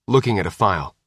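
ordinary delivery

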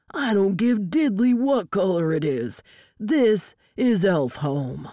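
irritated agent, emphatic slow finish; angry retort. A narrator's reading. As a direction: throw it back at him, then slow down hard. Rival agent snaps back in standoff.

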